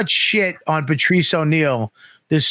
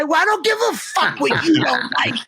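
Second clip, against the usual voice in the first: mock defiant; 8 semitones higher than usual.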